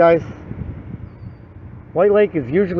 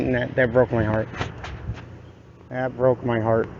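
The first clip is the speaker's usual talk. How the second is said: low, soft, subdued sadness; heartbroken; 7 semitones lower, 9 dB quieter than usual. Real, not acted. Parent recalling telling the child no visit tonight, quietly heartbroken. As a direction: say it quietly and low, hurt sinking in.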